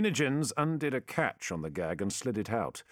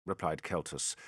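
ordinary delivery

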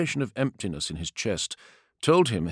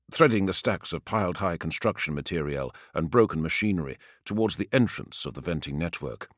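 plain reading voice

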